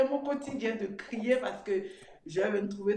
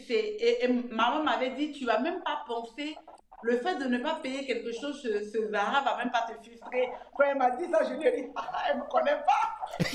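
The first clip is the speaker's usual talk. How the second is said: ordinary delivery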